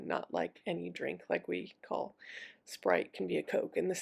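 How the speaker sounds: normal talking voice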